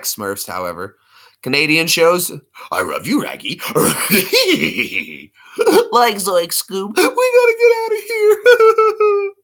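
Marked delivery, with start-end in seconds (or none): 2.7-5.3 cartoon dog voice, r's on the word starts; goofy talking dog, rising at the end; slurred cartoon dog with a giggle; cartoon dog impression. Shown as a demonstration. Scooby-Doo impression in show chat. 5.9-9.3 very high, loud, drawn-out panicked squeal; cracking high yell of cartoon panic; panicky cartoon sidekick. Shown as a demonstration. Shaggy impression, doing voices.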